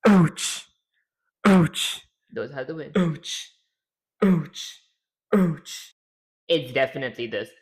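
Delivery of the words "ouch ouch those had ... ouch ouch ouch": quick falling pained ouch cries. Shown as a demonstration. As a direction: yelp each ouch like a game hit. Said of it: "Let's see".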